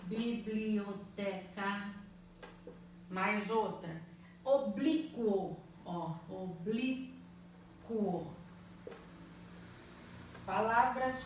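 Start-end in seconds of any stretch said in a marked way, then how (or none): 0.1-2.0 Biblioteca drawn out slowly, Bi-bli-o-te-ca, each syllable separate. Shown as a demonstration. Teacher to pupils, syllabifying slowly. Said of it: "Separar em sílabas". 6.3-8.3 Oblíquo pulled apart slowly, O-blí-quo, each syllable separate. Shown as a demonstration. Teacher to pupils, syllabifying slowly. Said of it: "Separar em sílabas".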